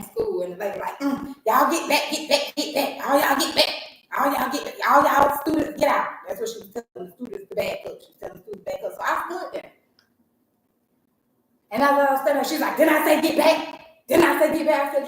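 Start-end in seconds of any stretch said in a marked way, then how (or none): 1.0-6.1 imitated loud, higher repeated commands; bossy ordering. Lady orders students out by counselor's office, imitated bossy ordering. 12.8-14.9 mimicked scolding, loud and higher. Lady scolds the parent who stood there, imitated scolding.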